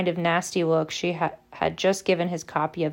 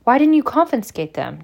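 demanding; 6 semitones higher, 5 dB louder than usual. A narrator's reading. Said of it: "demanded Harry".

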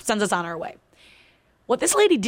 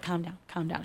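much softer, lower calm-down; imitating the sister soothing her; hushed calming; 4 semitones lower, 13 dB quieter than usual.

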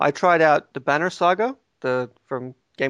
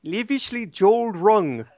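mock Kiwi accent, pitched higher; New Zealand accent; 6 semitones higher than usual. Shown as a demonstration. Showing co-hosts how Kiwis pronounce it, exaggerated New Zealand accent. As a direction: imitate a New Zealander's vowels. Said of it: "It comes out like this".

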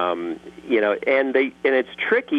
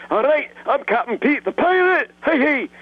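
much higher hearty pirate captain. Shown as a demonstration. Voice actor turning Pa Grape into a pirate. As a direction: roar it like a jolly cartoon pirate. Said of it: "I turn him into a pirate".

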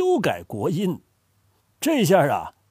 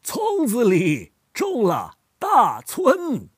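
slow Lanzhou accent, 村子 said as 葱子, a bit higher; 4 semitones higher, much slower than usual. Shown as a demonstration. Grandfather tries the Lanzhou accent for the child. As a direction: Lanzhou accent with nasals swapped, slowly. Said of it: "你说给我听听".

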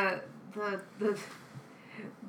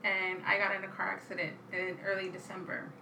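ordinary talk

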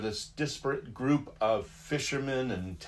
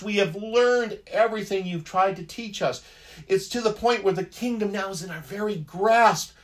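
exasperated protest; 6 semitones higher than usual. Retold or imitated. Disciples voiced in protest.

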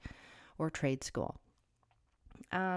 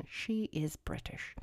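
slow whispered joke with a chuckle; laughing whisper; 7 dB quieter, slower than usual. Real, not acted. Vlogger joking that his inner voice is British, whispered and amused. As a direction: whisper it like a secret punchline.

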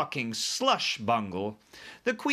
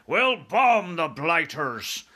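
loud, slow, higher military shout; 6 semitones higher, 6 dB louder, much slower than usual. A narrator's reading. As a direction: shout it as air force chief. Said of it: "shouted the head of the Air Force".

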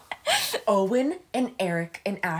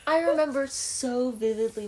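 ordinary talk

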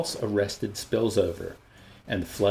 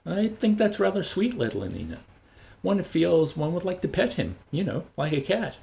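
fond; 9 semitones higher than usual. Acted. Lenina fondly describes Bernard.

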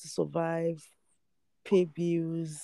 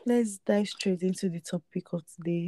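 ordinary talk

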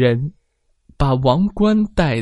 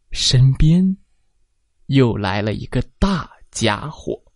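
ordinary delivery, nothing marked

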